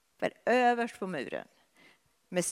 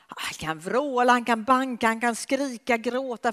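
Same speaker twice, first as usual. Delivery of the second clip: rising intensity; 6 semitones higher, 6 dB louder than usual. Acted. Listing desperate outcries.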